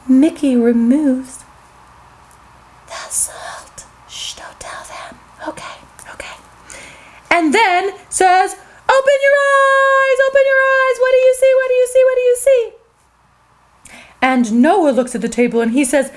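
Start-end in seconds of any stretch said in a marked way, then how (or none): 2.9-6.4 secret unvoiced whisper; hushed sneaky whisper. A narrator's reading. Mickey secretly removes the salt. 8.9-12.7 high, eager calling out; gleeful game host voice; excited call. Acted. Mickey calls friends to look.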